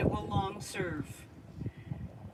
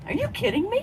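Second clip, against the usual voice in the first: much higher, loud squeal of disbelief; friend's catchphrase, squealed in loud disbelief; mock disbelief; 12 dB louder than usual. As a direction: push her catchphrase up to a squeal. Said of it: "you know that expression".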